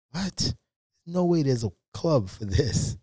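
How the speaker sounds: high incredulous "What?" then slow; stunned he can't believe it; disbelief. Retold or imitated. Told of a ping pong club, he answers in disbelief.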